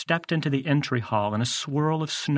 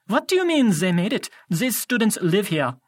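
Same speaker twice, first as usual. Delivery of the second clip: put-on raised, suspicious French-accented voice; 7 semitones higher, 3 dB louder than usual. A narrator's reading. Doctor Thorne questioning Grover's slip, French accent. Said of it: "He had an accent, uh, French maybe?".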